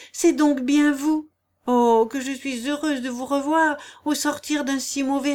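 high, loud, effusive delight; joyful relief. A narrator's reading. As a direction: gush with relief at seeing him. Wife relieved to see her husband. (soulagement joyeux, voix haute)

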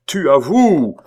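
loud, high accusing shout; triumphant cry. A narrator's reading. Abbé seeing guilt confessed, crying out. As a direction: cry it out like a stage tragedian. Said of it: "s'écria-t-il".

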